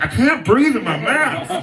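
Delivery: high, whiny imitation; mock whiny complaint. Mocking masked relatives complaining, whiny high voice. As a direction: whine it like a fed-up relative. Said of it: "they're like".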